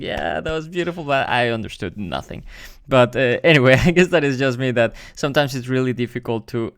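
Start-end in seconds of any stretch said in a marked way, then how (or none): 0.0-1.0 higher, imitated polite praise; pretend appreciation. Wedding guest clapping after the speech, pretend appreciation. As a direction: feign having understood and enjoyed it. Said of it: "I was like".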